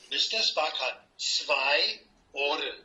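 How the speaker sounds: slow, clear words for a beginner; slow teacher talk. Shown as a demonstration. Teaching Roz German, slow and clear teacher talk. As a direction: spell it out slowly, like a patient language teacher. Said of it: "I want to give you a language lesson".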